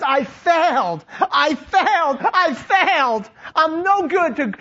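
loud-hearted, drawn-out cry of self-reproach; despairing, stretched-out admission; anguished confession. Acted. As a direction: wail it as a man who has given up on himself. Voices Peter despairing over failure.

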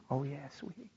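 barely audible, melting reply; very soft, low fatherly reassurance; tender forgiveness. Retold or imitated. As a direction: murmur it lovingly to your child. Father forgives his daughter.

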